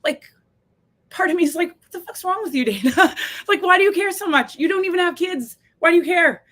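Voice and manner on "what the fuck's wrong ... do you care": higher-pitched incredulous self-reproach, half laughing; self-scolding inner voice. Acted. Part of her questioning herself, incredulous. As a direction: challenge yourself in disbelief, voice pitched up.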